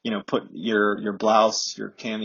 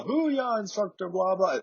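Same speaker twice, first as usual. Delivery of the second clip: high chanted mock cheer; imitated class yell; 10 semitones higher, slower than usual. Class answering the next call-out, high-pitched mock yell. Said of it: "the whole class in unison yells".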